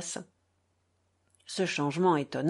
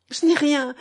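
a mild tearful protest, raised and louder; crying; 6 semitones higher, 6 dB louder than usual. A narrator's reading. Catherine waves off her tears to Alyosha, mildly tearful. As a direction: brush it off through light tears. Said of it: "poursuivit-elle en pleurant".

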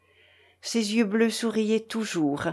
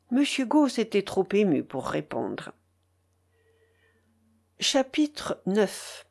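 ordinary delivery, nothing marked

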